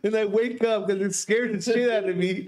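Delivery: high-pitched, laughing through the words; can barely get it out for laughing; real laughter cracking the voice up high; laughing hard. Real, not acted. Laughing while recalling the scare.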